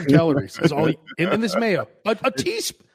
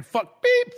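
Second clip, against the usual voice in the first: mock bleep; 3 dB louder than usual. Shown as a demonstration. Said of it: "and then you'll beep".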